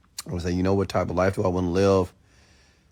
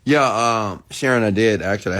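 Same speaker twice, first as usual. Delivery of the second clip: ordinary talk, nothing marked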